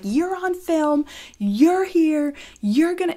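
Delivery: high, drawn-out mock accusation; mocking imitation. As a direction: mimic her slowly in a high put-on voice. Imitating opponent filming her.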